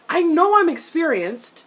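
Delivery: high, loud exaggerated repeat; incredulous mock quote. Repeating the offending line, mocking.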